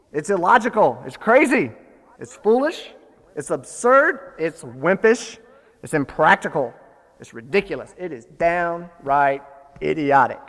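preacher voicing furious disbelief; indignant tirade. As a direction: hammer each charge home.

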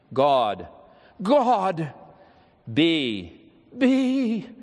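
full, earnest repetition, rising on each echo; snatch each word and throw it upward; intense earnest plea. Retold or imitated. Dying boy repeating the prayer after the chaplain, earnest. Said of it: "His voice was loud and full and most intense with earnestness".

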